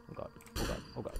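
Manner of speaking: whispered, repeated panic; terrified whispering under the breath; frightened whisper. Real, not acted. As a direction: whisper it over and over in fear. Low health, frightened.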